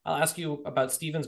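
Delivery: normal talking voice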